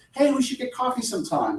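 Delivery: friendly offhand coffee invite; breezy invite. Retold or imitated. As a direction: toss it off like nothing. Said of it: "no big deal".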